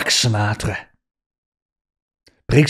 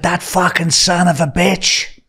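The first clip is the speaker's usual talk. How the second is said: slow, pitched-up mock curse; imitated curse; 8 semitones higher than usual. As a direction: drag out his angry curse. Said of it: "He went".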